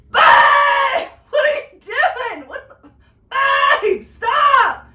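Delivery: high, very loud shriek of alarm; shocked yell. Retold or imitated. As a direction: scream at him to stop, horrified. Wife yelling at husband peeing on laundry.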